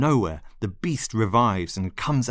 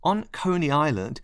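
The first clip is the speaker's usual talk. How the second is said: sharp, higher angry burst; exploding; 7 semitones higher than usual. Acted. Lionel snapping at the narrator, angry outburst. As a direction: blow up at her. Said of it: "he exploded".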